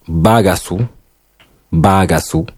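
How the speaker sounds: "bagasu" with long vowel held; long vowel demo. Shown as a demonstration. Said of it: "Par exemple".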